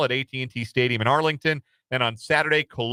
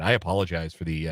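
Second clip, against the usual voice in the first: ordinary talk, nothing marked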